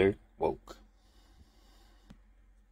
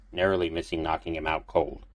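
plain reading voice

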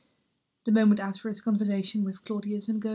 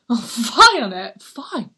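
sudden loud burst of disgust; disgusted outburst; 10 dB louder than usual. Acted. Hamlet railing alone at the world, a loud disgusted outburst. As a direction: explode on the first fie, then drop back.

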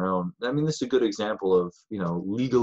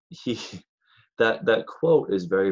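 usual speaking voice

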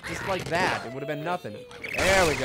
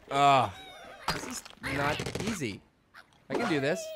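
normal talking voice

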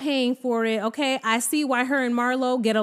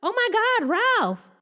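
mock shocked; 10 semitones higher, faster than usual. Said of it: "She was like".

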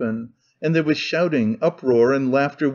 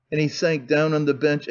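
usual speaking voice

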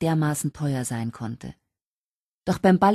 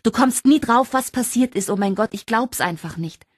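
much higher and louder, tumbling out the news; bursting excitement; 7 semitones higher, 4 dB louder than usual. Acted. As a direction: spill the news, beside yourself with joy.

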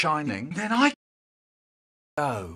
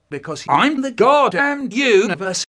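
grand proclamation; 8 semitones higher, 9 dB louder than usual. Acted. Grandiose declaration.

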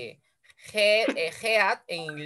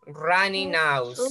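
slow model phrase; much slower than usual. Shown as a demonstration. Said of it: "teacher models new vocabulary".

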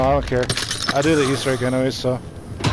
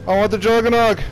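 frustrated yell; 7 semitones higher than usual. Real, not acted. Frustrated, loadout missing.

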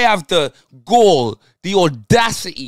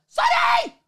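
shout. Real, not acted. Live reaction to a chance.

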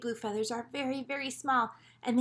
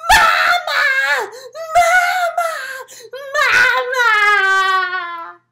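very high, very loud crying shout; scared baby bird cry; 18 dB louder, much slower than usual. Acted. Baby birds crying out for their mama, high and loud. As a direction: wail for mama like a frightened chick. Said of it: "in a really big voice that only babies can do".

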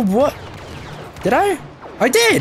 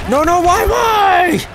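high, loud panicked cry of no and why; desperate shout; 5 dB louder, much slower than usual. Real, not acted. Player wails at the enemy shooting him, high and loud. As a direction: yell it as you get gunned down. Getting shot in the game.

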